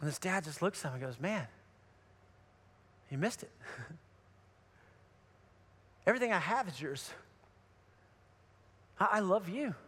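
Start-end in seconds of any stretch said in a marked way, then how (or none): 1.2-9.8 hushed, tender reply; gentle sorrow. Retold or imitated. Father answering his angry older son, quiet tenderness. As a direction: drop right down after the outburst, softly reassuring.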